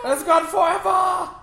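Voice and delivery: high, loud rallying shout; viking battle cry. Pretend. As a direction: yell it like a warrior charging.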